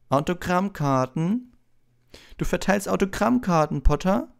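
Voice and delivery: mock him publicly, high and loud; loud scornful schoolboy rival; loud, cutting Malfoy. A narrator's reading. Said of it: "Laut und schneidend hallte Draco Malfoys Stimme".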